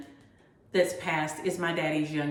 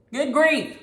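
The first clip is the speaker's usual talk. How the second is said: "Good grief" higher and louder, exasperated; 8 semitones higher, 5 dB louder than usual. Real, not acted. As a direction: throw up your hands: "Good grief".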